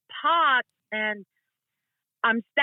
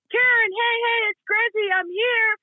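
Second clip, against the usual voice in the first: calling out; 11 semitones higher than usual. Retold or imitated. Gregory calling to Karen through the market crowd, raised and higher.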